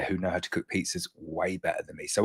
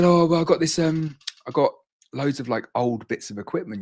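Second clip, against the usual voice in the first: higher, lightly imitated friend's accent; Damien's accent; 6 semitones higher, 4 dB louder than usual. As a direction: quote him in a hint of his accent. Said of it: "Damien does talk like that".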